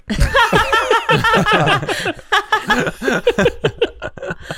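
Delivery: long, high laughing fit. Real, not acted. Ended up treating her like a girlfriend, long high laugh. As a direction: crack up helplessly at your own story. Cracks up after girlfriend remark.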